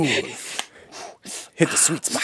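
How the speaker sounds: slow, breathy mock seduction; sultry whisper. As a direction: purr it out slowly, teasing.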